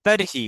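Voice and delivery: তর্হি in two careful syllables, r then h sounded; pronunciation demo. Shown as a demonstration. Teacher showing how the word is pronounced, careful তর্হি with r-h. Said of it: "উচ্চারণটা হবে তর্হি".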